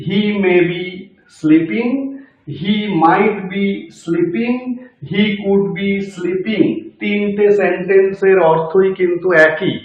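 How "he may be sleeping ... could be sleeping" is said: very slow, word by word; slow dictation. Shown as a demonstration. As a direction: spell it out slowly for the class. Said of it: "আমি ভেঙে ভেঙে বলছি প্রত্যেকটাকে". (ধীরে ধীরে ভেঙে বলা)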